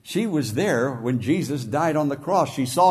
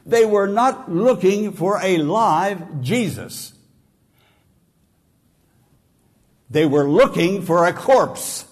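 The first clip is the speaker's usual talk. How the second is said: loud, raised preaching voice; forceful preaching; 6 semitones higher, 7 dB louder than usual. Acted. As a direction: build it up and hammer the point. Preacher drives home the point.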